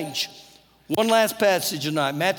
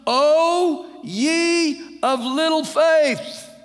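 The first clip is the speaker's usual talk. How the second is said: very slow, louder, higher rebuke as Jesus; 6 semitones higher, 4 dB louder, much slower than usual. Retold or imitated. Jesus gently chides the sinking Peter, slow and drawn out. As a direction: draw out each word of the rebuke.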